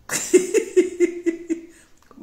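shrieking high laughter, very loud; real laugh, high and loud; loud high cackle. Real, not acted. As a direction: let out a piercing cackle.

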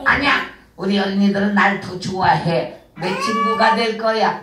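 low, sly villain puppet voice; a low tempter coaxing the child. Acted.

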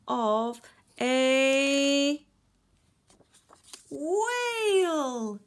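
drawn-out, loud, high announcement; storyteller's loud, swelling climax; dramatic reveal. A narrator's reading. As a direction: build to a big high reveal. Big reveal of the whale.

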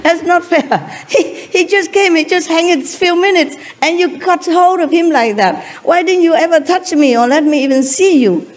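high-pitched, aggrieved complaint; indignant protest. Acted. Devotee asks God why another was favoured, aggrieved protest.

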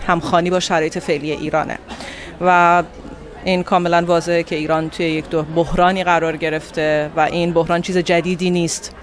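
normal talking voice

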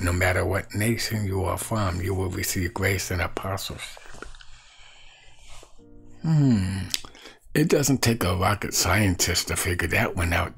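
ordinary talk, nothing marked

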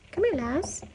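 pet talk. Real, not acted. Calling shelter cat Lazarus to come over, in high pet talk. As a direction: call him over in a high coaxing lilt.

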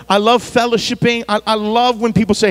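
ordinary delivery

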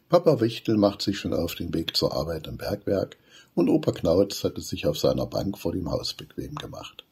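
usual speaking voice

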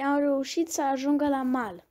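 plain reading voice

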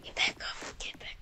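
hushed urgent whisper. Real, not acted. Urging himself in game.

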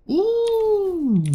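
very high, long-held ooh. Real, not acted. Player reacting mid-game, high drawn-out ooh.

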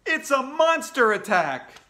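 loud, high, drawn-out alarm; yelled warning. A narrator's reading. Kid warning everyone in the hallway, yelling. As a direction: yell it in panic. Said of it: "some kid yelled".

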